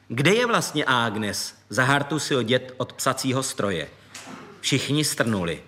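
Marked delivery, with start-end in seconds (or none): none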